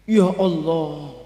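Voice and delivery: slow, drawn-out dismayed "ya Allah"; retold dismay, words stretched out; dismayed sigh. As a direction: let it sink, slow and heavy. Prophet sees his people in hell.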